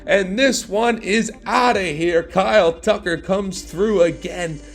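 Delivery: big rising shout over the crowd; excited home run call. Acted. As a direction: sell the homer with a roar.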